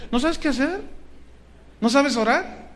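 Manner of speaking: "¿No sabes qué hacer?" thrown back high with a rise on "sabes"; preacher challenging the congregation, high and rising on "sabes"; challenging question. Shown as a demonstration. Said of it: "y no sabes qué hacer".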